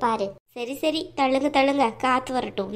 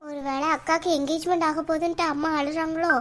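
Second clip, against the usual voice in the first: ordinary talk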